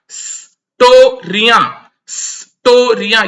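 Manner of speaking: स्टोरियां drawn out slowly, स then टो then या; teacher slowly sounding out स्टोरियां; slow syllable demo. Shown as a demonstration. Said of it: "ये जो word है स्टोरिया".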